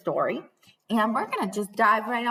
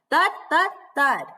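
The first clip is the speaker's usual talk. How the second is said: louder, higher thuds; a little loud; 6 semitones higher, 5 dB louder than usual. Shown as a demonstration. Teacher showing loud dark words, louder thuds. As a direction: knock out "Thud, thud, thud" loudly. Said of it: "That's why I say them a little loud".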